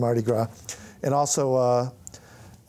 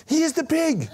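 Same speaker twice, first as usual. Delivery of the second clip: put-on very high, delighted kid voice; gleeful young fan; 13 semitones higher than usual. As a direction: point and announce it like a thrilled teen. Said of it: "And he said".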